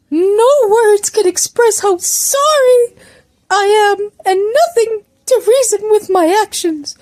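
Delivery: high, tearful, drawn-out wail; hammed-up high crying voice; anguished apology. A narrator's reading. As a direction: apologise as if barely holding it together. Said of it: "managed to keep himself together".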